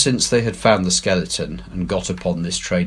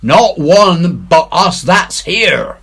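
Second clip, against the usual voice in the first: defiant pirate; 10 semitones higher, 9 dB louder, slower than usual. A narrator's reading. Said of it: "he muttered".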